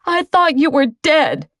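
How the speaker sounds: high, upset outcry; exploding. Acted. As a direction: burst out at him, shaken. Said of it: "she exploded".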